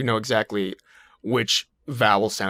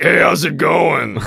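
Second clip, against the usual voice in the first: mock voice; 6 semitones higher, 10 dB louder, slower than usual. Impersonating a voice.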